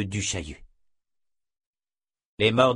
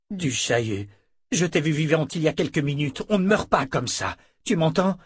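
desperate pleading; 6 semitones higher than usual. Acted. Richard pleads over the corpse.

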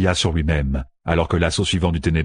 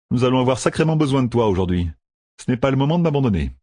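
fast, higher character voice; urgent plea; 6 semitones higher, faster than usual. A narrator's reading. Matt begs his luck to stay, fast and urgent. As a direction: rattle it off, coaxing your luck.

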